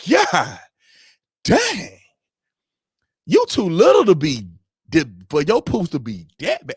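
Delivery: high, stunned exclamation in bursts; hammed-up shock, pitch leaping up; mock appalled disbelief. Dad reacting to his baby's messy diaper, mock appalled.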